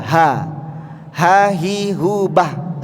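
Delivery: "Ha hi hu bah" dengan h tebal berhembus; ha dari dada. Shown as a demonstration. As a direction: ucapkan "Ha" dari dada, pelan satu per satu. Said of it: "terasa di dada". (bunyi h tebal pada "Ha")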